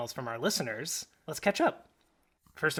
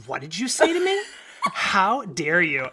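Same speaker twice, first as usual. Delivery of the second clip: mock parental outrage; 5 semitones higher, 8 dB louder than usual. Retold outraged parent scolding child.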